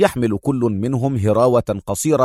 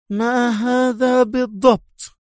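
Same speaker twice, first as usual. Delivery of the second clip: much higher, slow and tense; tense demand; 11 semitones higher, slower than usual. Acted. The major confronting the inspector, slow and tense. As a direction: hold back anger, ask slowly. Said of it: "انقبضت قبضتها في تحفز".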